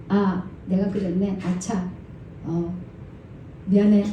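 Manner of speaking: quiet, gentle admission of a mistake; soft apology. Retold or imitated. Husband gently says sorry to his wife, soft and sincere. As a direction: own up softly, no defensiveness.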